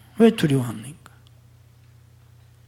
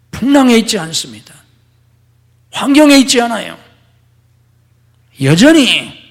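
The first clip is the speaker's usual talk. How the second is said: forceful preaching; 8 semitones higher, 9 dB louder than usual. Acted. Climactic preaching.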